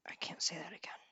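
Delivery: whispered to herself, voiceless. Real, not acted. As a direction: breathe it out as a secret whisper. Repeats phrase as a whisper.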